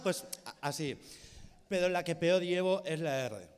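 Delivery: usual speaking voice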